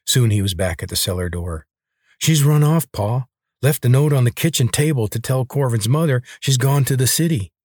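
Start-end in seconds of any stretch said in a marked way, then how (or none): 2.2-7.5 put-on higher, eager country boy; young boy bragging to his father; boyish son voice. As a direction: pitch it up like a boastful kid.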